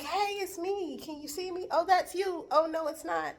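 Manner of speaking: high-pitched, small imitation of calling out; hopeful little high voice, not really shouting; mock hailing. Traveler flags down a possible driver, in a high mock call.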